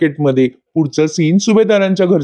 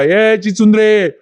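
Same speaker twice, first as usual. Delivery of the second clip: higher, drawn-out taunting call from behind; mock shout; 5 semitones higher, slower than usual. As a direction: call it out slowly on purpose, as a half-played yell. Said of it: "मुद्दाम मैपत जोरात ओरडतो".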